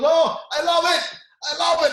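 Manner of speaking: high, loud shout of devotion; imitated screaming. Wrestler screaming back at his coach while sprinting, imitated. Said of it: "now he's screaming as he's sprinting".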